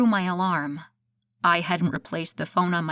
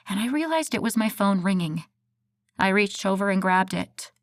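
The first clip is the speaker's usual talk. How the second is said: plain reading voice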